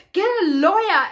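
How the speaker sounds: loud, high mock-furious order; angry friend. Friend demanding she get a lawyer, hammed-up anger. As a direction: imitate an outraged friend's yell. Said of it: "she was so angry".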